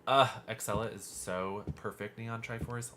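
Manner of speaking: ordinary talk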